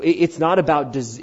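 normal talking voice

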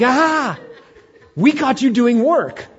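high, loud gotcha cry; hammed-up busybody catching someone out; mock Sabbath police. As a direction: pounce on a rule-breaker, comically shrieking. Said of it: "the Sabbath police jump out of the field".

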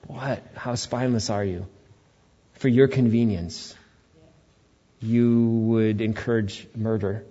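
normal talking voice

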